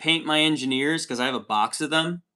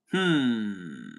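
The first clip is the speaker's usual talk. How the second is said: normal talking voice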